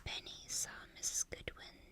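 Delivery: ordinary delivery, nothing marked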